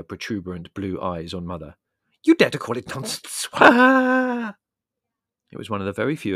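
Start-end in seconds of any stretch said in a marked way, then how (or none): 2.2-4.5 loud, raised indignant challenge; outraged. A narrator's reading. Medium challenging Mother's disbelief, outraged. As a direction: thunder it, climbing into a high wail. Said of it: "leaving the raging Missus Haddock".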